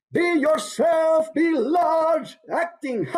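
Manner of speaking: high, drawn-out theatrical proclamation; big hammed-up acting. Shown as a demonstration. Teacher shows how to be big, hammed-up acting. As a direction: go huge and grand, very slow and high. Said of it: "And be big".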